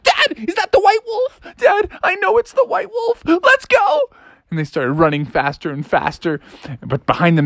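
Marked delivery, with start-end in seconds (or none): 0.0-4.1 very high, frightened squeak; panicked Froggy. Acted. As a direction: squeal urgently to your father. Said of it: "And Froggy's like".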